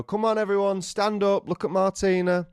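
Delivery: high, excited rallying call; calling to guests. Retold or imitated. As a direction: get the whole congregation on its feet. Rousing guests as the bride enters.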